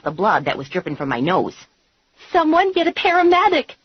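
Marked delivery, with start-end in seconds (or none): 2.3-3.8 high, loud panicked shout. A narrator's reading. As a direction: call for help at the top of the voice. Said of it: "Valerie shouted".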